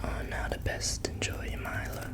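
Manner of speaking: soft unvoiced ASMR whisper; hushed ASMR murmur. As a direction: breathe it close to the mic.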